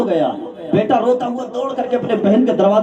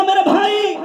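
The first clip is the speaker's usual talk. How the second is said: rising emotional appeal; 7 semitones higher than usual. Acted. Preacher builds emotional peak.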